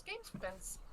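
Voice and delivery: squeaky high little creature. Acted. Creature chanting offer.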